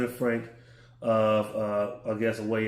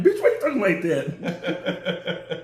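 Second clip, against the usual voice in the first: laughing; 4 semitones higher, much slower than usual. Retold or imitated. Friend's mock reply, laughing.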